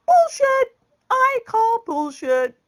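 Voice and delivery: high, loud call of disbelief; scornful high-pitched shout; loud scoffing outburst. Real, not acted. Mocking the daughter's excuse.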